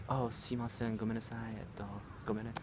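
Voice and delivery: soft, timid mock apology; imitating meek apology. Wife apologizing to store staff, meekly imitated. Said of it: "they know she'll back down easier".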